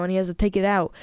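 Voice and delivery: usual speaking voice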